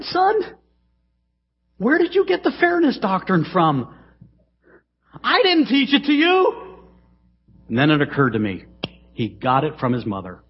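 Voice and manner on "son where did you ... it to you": high, hammed-up mock father; father answering his son in a hammed-up high voice; mock fatherly reply. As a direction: play the amused dad answering.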